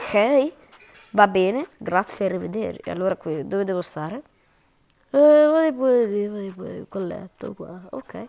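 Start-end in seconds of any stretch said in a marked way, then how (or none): 5.1-6.3 higher, louder nurse pointing to the bed; loud staff voice. Pretend. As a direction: boom out the directions like a busy nurse. Voicing the hospital staff.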